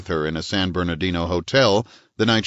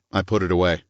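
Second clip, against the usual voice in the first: ordinary delivery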